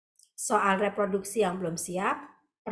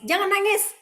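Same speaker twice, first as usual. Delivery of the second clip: sharp scold, pitched higher and louder; scolding parent; 9 semitones higher, 5 dB louder than usual. Retold or imitated. Old-style parent scolds crying son, raised sharp voice. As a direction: snap at the crying boy to stop. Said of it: "anak laki-laki nangis".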